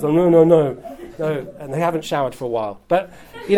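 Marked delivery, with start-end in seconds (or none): 0.1-1.5 put-on mopey teenage boys. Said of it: "slightly mopey teenage boys".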